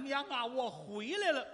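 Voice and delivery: high drawn-out call to mother. Acted. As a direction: call out as a returning son. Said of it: "这儿一喊".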